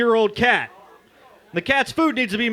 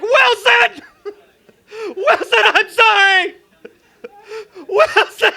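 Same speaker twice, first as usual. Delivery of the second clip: screaming high and loud, half sobbing; anguished wailing; 14 semitones higher, 6 dB louder, slower than usual. Pretend. Calling after his lost ball in a high, loud mock wail. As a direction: wail after it like Tom Hanks losing Wilson.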